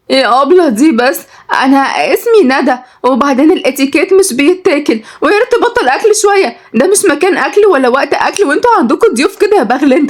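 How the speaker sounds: high, offended scolding; irritated city lady. Acted. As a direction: scold the greedy boy in a high, haughty voice. Voices snobbish Nada scolding the boy. (بصوت عالي الطبقة، متضايقة ومشمئزة)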